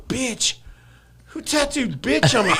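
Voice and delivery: high, incredulous, laughing through it; man finding a tattoo in the mirror, incredulous; shocked disbelief. Retold or imitated. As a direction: sound outraged and baffled at once.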